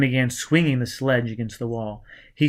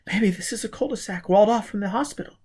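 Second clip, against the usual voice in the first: put-on scared young woman; 8 semitones higher, faster than usual. A narrator's reading. Gisbella's voice.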